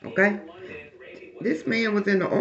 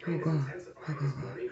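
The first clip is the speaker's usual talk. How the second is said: fading hushed prayer, softer; scared praying; 2 semitones lower, 10 dB quieter than usual. Retold or imitated. Scared woman praying in the barracks, hushed and fading. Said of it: "I was praying".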